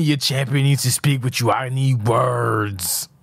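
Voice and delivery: last words hugely drawn out; mock quote. Boss quoting the champion's message back, mocking drawl. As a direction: quote it back, stretching the ending.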